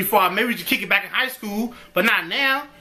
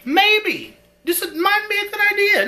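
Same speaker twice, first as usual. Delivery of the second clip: high teasing maybe; 13 semitones higher than usual. Shown as a demonstration. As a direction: toss off a sly, high-pitched maybe. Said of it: "repeats maybe in two voices".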